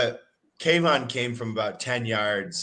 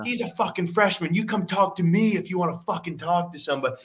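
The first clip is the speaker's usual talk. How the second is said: retold yell; 7 semitones higher than usual. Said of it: "I'm like".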